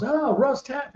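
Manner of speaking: imitating the doctor, high and softly thrilled; high-pitched delighted recognition, retold; doktor taklidi. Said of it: "He goes".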